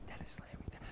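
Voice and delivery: imitated whisper, barely audible; hushed aside. Comedian secretly pointing fans to his famous friend, whispering. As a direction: whisper the name to tip off the fans.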